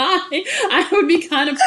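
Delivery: slow laughing, high up. Real, not acted. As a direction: laugh through the words.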